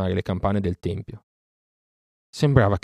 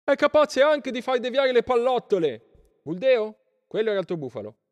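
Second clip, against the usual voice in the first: shouting villagers. A narrator's reading. Villagers complaining to Buldeo, crying out high. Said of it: "gridarono i contadini".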